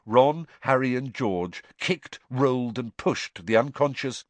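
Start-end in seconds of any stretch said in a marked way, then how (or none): none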